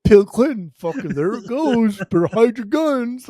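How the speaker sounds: loud, high, drawn-out mock outrage; hammed-up conservative relative raging at the result; devastated relatives. Podcaster mimics his dad's family on Clinton's win, mock devastated.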